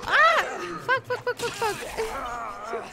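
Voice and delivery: high scared shout; startled, swearing in fright; panicked. Real, not acted. Monster jumps at her. (испуганно, в панике)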